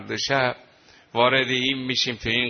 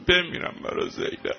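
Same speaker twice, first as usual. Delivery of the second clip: hushed lament; 4 semitones higher than usual. Real, not acted. Rawzeh lament for Zeinab.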